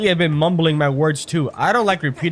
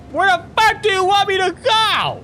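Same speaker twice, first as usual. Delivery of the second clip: very high exasperated scream; real gamer outburst, pitched way up; frustrated shout; 3 dB louder than usual. Real, not acted. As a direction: yell it, totally lost and fed up. Yelling at game directions.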